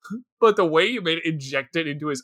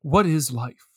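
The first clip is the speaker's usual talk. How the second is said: lightly voiced pseudo-philosopher musing; a hint of a pompous ponderer; pretentious philosopher; 5 semitones lower, 3 dB quieter than usual. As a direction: mutter it like a deep thinker. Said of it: "read Rene Descartes".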